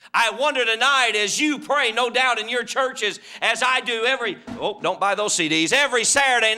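loud, ringing preacher's cadence; fervent revival preaching; preaching at full force. Acted. Preacher builds to fervent appeal.

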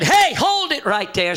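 high, slow call to halt; calling out to stop them. Retold or imitated. Preacher stopping the gravediggers from filling the hole, high slow call. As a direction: hold it, called out high.

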